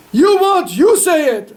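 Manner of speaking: sudden high, loud retort; indignant outburst. Retold or imitated. Son-in-law throwing the honour back at his father-in-law, loud. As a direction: fling it back at him.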